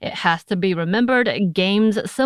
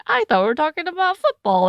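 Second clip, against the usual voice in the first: much higher, mock-whiny complaint; whiny listener; 11 semitones higher than usual. Imagined listener complaining to the host, high and whiny. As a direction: play a confused listener griping. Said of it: "someone hits up and they're like".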